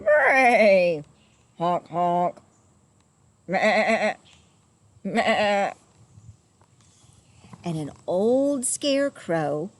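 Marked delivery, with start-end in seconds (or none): 0.0-1.0 loud braying call, like a donkey. A narrator's reading. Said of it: "They were going". 1.6-5.7 honking then bleating, animal voice; goose and goat noises. A narrator's reading. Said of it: "They were going".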